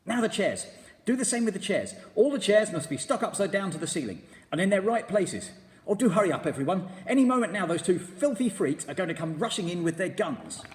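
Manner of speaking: high, loud, hurried excitement; bursting with urgency; excited. A narrator's reading. Mugglewump urging everyone to hurry, excited and loud. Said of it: "I'm so excited".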